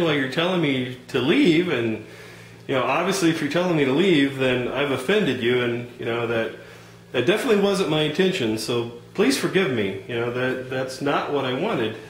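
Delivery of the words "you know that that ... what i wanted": usual speaking voice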